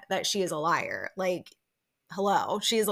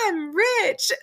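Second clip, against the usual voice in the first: much higher, playful put-on voice; mock smug Angie; 12 semitones higher, 4 dB louder than usual. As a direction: tease her like a haughty rich lady. Voicing Angie holding the flowers.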